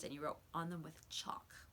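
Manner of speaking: soft whispered aside; an aside to the listener, whispered. Real, not acted.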